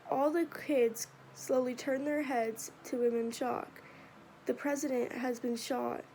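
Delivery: plain reading voice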